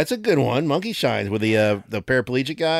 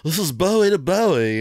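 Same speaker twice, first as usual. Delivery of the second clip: higher, put-on singer voice; 7 semitones higher than usual. Shown as a demonstration. Host demonstrating another celebrity voice, mock impression. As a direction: do a joking impression, pitched up. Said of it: "or I can do, like".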